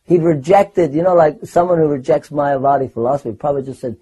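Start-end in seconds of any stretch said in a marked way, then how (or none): none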